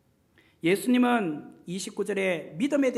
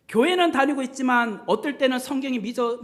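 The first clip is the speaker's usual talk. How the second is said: ordinary delivery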